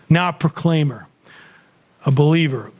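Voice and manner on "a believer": normal talking voice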